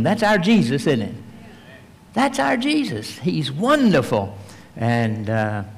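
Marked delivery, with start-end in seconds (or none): 2.1-3.2 high joyful echo of the praise; rising praise. Real, not acted. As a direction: repeat it much higher with joy. Preacher repeats praise higher.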